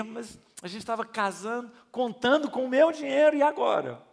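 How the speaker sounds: despairing cry. Retold or imitated. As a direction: panic about the lost salary. Bride despairs about money. (desespero agudo e mais forte)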